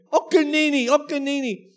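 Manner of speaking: put-on high, loud little-girl call; toddler yelling. Dad mimicking his daughter in the pool. Toddler's yell retold again.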